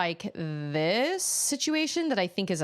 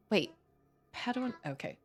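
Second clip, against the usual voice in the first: ordinary talk, nothing marked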